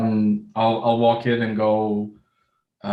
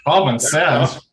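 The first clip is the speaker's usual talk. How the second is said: incredulous; 4 semitones higher, 6 dB louder, slower than usual. Real, not acted. Incredulous echo of GM.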